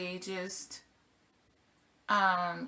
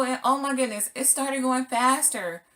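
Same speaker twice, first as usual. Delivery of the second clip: usual speaking voice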